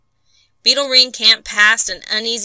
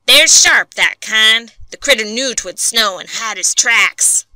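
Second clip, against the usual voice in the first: scornful loud warning; 3 semitones higher, 7 dB louder than usual. A narrator's reading. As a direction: hammer the point home. Bates scorning the thief.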